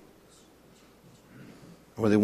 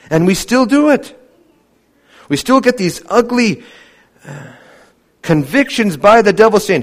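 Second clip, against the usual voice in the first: higher, louder preaching voice; 7 semitones higher, 5 dB louder than usual. Acted. Preacher warning the church about old guilt, rising preaching. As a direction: drive the point home from the pulpit.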